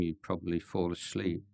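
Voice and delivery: ordinary delivery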